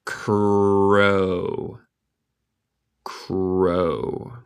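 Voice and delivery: "crow" very slow, one long drawn-out syllable; stretched pronunciation model. Shown as a demonstration. Teacher answering a learner's request to repeat crow, stretched out. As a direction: hold the sounds of "crow". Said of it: "la palabra cuerva se dice".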